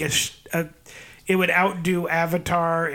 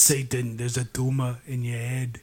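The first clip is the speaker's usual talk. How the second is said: lower, deep put-on action hero voice; mock Arnold; 4 semitones lower, 5 dB quieter than usual. Friend playing Arnold taunting Satan in a deep action voice. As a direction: growl it like a muscle-bound movie hero.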